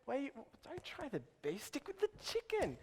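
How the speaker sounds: a lightly voiced high waiter; a hint of a fussy waiter steering you; waiter's advice. A lightly put-on waiter urging you to stick with the chicken.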